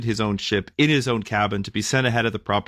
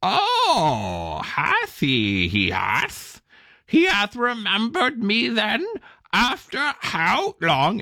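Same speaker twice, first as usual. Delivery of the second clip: much higher, slow croaking bird voice; indignant raven; 12 semitones higher, much slower than usual. Acted. Sulking raven answers the angel with wounded pride. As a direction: squawk out the injured pride of a neglected raven. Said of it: "the raven turned a yellow eye".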